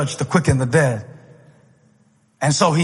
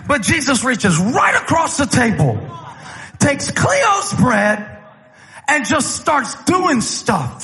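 full-force preaching; 7 semitones higher than usual. Acted. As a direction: preach it with rising fire.

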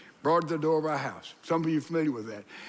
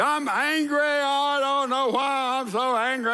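mock angry complaint; 7 semitones higher, 4 dB louder than usual. Preacher voices an angry congregant.

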